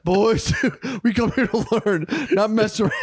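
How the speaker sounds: hammed-up angry priest; mock mad priest. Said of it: "He got all mad and serious".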